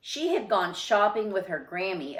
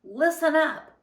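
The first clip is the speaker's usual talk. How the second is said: higher, louder mock command; bossy teacher; 9 semitones higher, 5 dB louder than usual. Girl bossing her baby brother as teacher, mock stern command. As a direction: bark it like a teacher calling the class to order. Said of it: "Lily pretended to be Mister Slinger".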